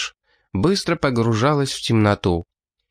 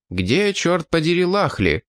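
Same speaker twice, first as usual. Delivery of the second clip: indignant; 6 semitones higher than usual. A narrator's reading. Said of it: "возмущался".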